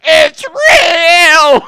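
high, drawn-out mock wail; exaggerated cry of sudden belief; imitated tearful scream. Imitating crying girl finding God's real, wailing. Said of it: "and she's crying".

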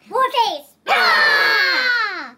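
high shouted command into a long yell; war cry. Acted. Battle reenactor showing the group his war face, shouted war cry. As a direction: holler it like a mock battle charge.

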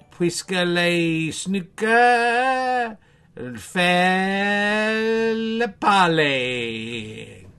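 drawn-out mock French; very slow. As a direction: stretch every word out very slowly.

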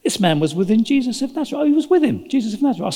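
a hint of an excited female accuser; publicly accusing girl. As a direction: raise the pitch as an eager witness informing everybody. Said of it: "in earshot of everybody".

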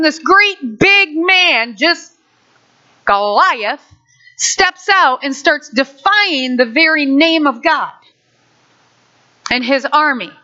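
ordinary delivery

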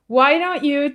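ordinary talk, nothing marked

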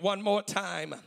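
normal talking voice

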